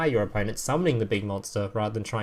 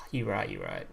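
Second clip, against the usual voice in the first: sighing; 4 semitones lower, 12 dB quieter than usual. Real, not acted. Conceding a viewer's point.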